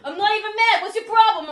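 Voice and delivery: high, very loud mock East Coast outburst; imitated abrasive East Coast shouting; East Coast yelling. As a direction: yell it like an aggrieved East Coaster. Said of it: "it just always sounds like they're yelling".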